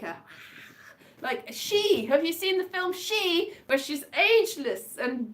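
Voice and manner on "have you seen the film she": ordinary delivery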